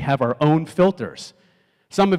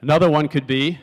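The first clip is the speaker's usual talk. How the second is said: ordinary talk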